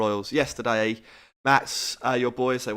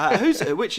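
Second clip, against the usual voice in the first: laughing; 10 semitones higher, 7 dB louder than usual. Real, not acted. Laughing at Harry Potter joke.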